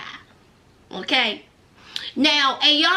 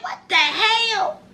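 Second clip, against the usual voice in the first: slow, higher drawn-out what the hell; mock disbelief; 7 semitones higher, slower than usual. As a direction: stretch it out in disbelief. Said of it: "I said".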